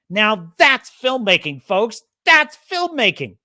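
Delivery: mock announcer praising the film; hammed-up pundit voice. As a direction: proclaim it like a TV host.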